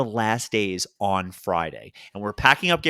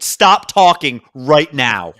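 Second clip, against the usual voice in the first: firm stop; 8 dB louder, much slower than usual. Retold or imitated. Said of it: "I said".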